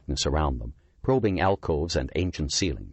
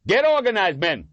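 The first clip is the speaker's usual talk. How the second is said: loud, high rallying shout; rally the men over the chaos; crying out orders; 14 semitones higher, 5 dB louder than usual. A narrator's reading. Lieutenant crying out orders during the ambush. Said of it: "Miller cried".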